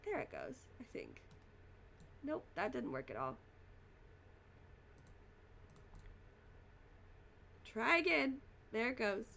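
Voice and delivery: strained, about to cough; holding back a cough. Real, not acted. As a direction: fight the urge to cough. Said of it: "I'm about to cough again".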